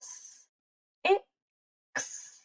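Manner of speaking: "S, i, x" very soft, sound by sound; softly segment "S, i, x"; quiet sounding out. Shown as a demonstration.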